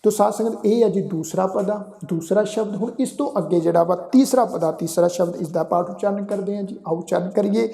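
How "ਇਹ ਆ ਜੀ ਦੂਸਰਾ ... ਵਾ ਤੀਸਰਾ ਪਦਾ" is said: normal talking voice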